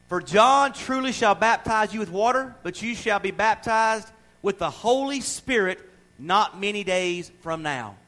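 loud, slow preacher reading the verse; a preacher reading scripture to the church. Acted. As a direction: proclaim the verse slowly and loudly. Reads scripture aloud.

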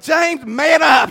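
slow, drawn-out stern telling-off; wife scolding. Retold or imitated. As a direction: drag each word out like a fed-up wife. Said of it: "And Melanie said".

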